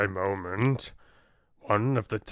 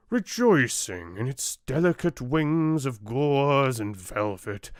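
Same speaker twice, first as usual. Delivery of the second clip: ordinary delivery, nothing marked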